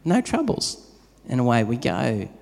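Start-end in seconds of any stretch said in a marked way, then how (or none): 0.0-0.9 cheerful yes. Retold or imitated. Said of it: "We said".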